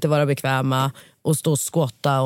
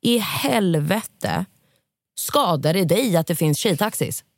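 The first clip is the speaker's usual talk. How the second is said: exasperated; 3 semitones higher, much slower than usual. Real, not acted. Outraged rant.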